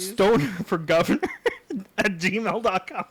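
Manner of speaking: reading through laughter; cracking up. Real, not acted. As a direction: get it out while cracking up. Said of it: "that cracks me up".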